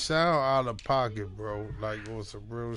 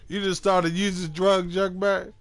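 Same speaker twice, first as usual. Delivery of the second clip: mock scolding; 8 semitones higher, 8 dB louder than usual.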